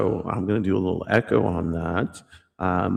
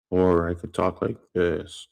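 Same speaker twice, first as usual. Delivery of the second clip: natural low voice; 5 semitones lower than usual. Shown as a demonstration. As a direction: drop into a low register.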